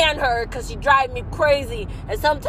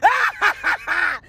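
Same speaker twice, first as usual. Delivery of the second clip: shouting laugh; 3 semitones higher than usual. Real, not acted.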